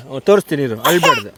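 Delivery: calm the little one over her crying. Real, not acted.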